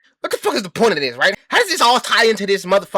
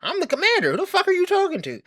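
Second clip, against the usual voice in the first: high-pitched outraged protest; indignant retort; 12 semitones higher than usual. Retold or imitated. Arguing with the military superior, high and indignant. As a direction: squeal back at the officer in disbelief. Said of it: "And I'm like".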